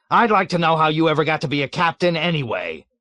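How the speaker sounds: loud, high indignant bluster; blustering wrath. A narrator's reading. Colonel blustering furiously at the captain. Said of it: "blustering wrath".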